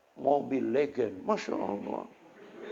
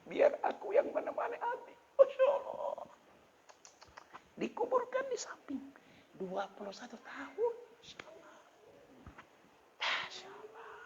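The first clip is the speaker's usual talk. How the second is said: high, broken sobbing voice; choking out the words in tears; crying; 8 dB quieter than usual. Real, not acted. Preacher weeping over the boy's words.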